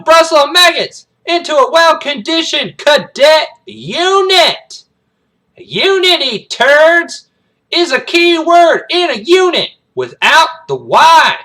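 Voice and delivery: drill-instructor tirade, high and very loud; slow, hammered shouting at new cadets; Major Payne. Acted. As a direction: bellow every word slowly to assert authority. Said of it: "He's like".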